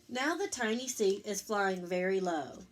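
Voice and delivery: gentle picture-book read-aloud; reading to children. A narrator's reading. Parent reading Eric Carle to Lucas, gentle storytelling.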